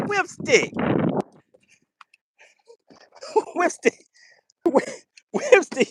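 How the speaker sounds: calling the name high through laughter; laughing. Real, not acted. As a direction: giggle his name out, barely holding it together. Laughing at a viewer's joke.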